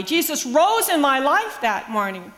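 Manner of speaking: ordinary talk, nothing marked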